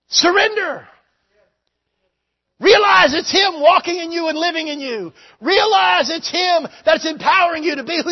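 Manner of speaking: loud, high fervent preaching shout; full-force preaching. Acted. Preacher shouting to his congregation at full force. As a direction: preach it out at the top of the voice.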